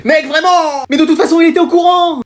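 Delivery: half-comic exasperation; exasperated protest. Acted. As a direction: scold him for giving it away. Exasperated at friend confessing.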